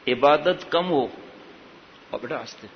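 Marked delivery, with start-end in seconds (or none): 2.1-2.7 quiet murmur away from the audience; soft aside. Real, not acted. Aside to child mid-sermon.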